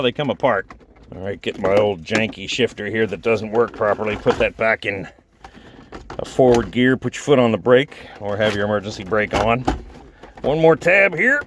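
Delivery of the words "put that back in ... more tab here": ordinary talk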